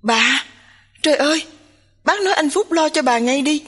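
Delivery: urgent, worried outcry; crying out. A narrator's reading. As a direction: exclaim in mild alarm, pitch jumping up. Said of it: "Thanh Duy kêu lên".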